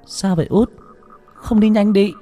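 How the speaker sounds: high-pitched, hurried questioning; urgent. A narrator's reading. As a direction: urge your little sibling on in a high voice. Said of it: "hỏi bằng giọng gấp gáp". (giọng gấp gáp, cao)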